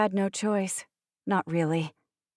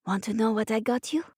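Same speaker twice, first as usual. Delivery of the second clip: put-on higher-pitched child's question; little boy; 4 semitones higher than usual. A narrator's reading. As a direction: ask like a small boy with a secret. Narrator voices the little French boy.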